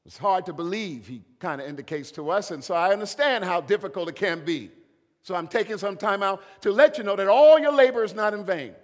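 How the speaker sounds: loud, rising sermon climax; full-force preaching. Acted. Preacher building to a loud climax for the congregation.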